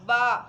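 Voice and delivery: बा called out loud and short; loud letter sound. Shown as a demonstration. Teacher to children, showing the बे sound: loud बा. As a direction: punch out बा for the kids. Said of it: "बे का sound कैसे निकलता है?".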